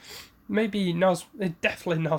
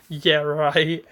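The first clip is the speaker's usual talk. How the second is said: a louder scoffing Yeah, right; derisive sneer; 5 dB louder than usual. Retold or imitated. Sasuke scoffing at Naruto's boast, derisive sneer. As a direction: sneer it out, dismissive. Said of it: "derisive sneer".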